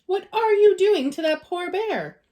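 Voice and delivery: high, loud indignant scold; wife scolding husband over the bear. A narrator's reading. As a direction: tell him off in dismay.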